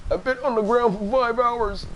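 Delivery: a hint of a weary old man complaining; old man impression. Streamer hamming a tired old man for chat. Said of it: "terrible old man impression".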